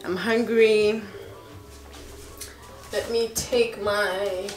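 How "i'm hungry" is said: ordinary talk, nothing marked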